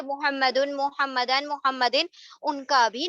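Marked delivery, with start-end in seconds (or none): 0.0-0.8 محمدن ending in a short u; "un" sound closing محمدن. Shown as a demonstration. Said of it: "ڈکلینشنز جو ہم نے ابھی دیکھے". 0.8-1.5 محمدن ending in a short a; "an" sound closing محمدن. Shown as a demonstration. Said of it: "ڈکلینشنز جو ہم نے ابھی دیکھے". 1.5-2.1 محمدن ending in a short i; "in" sound closing محمدن. Shown as a demonstration. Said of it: "ڈکلینشنز جو ہم نے ابھی دیکھے".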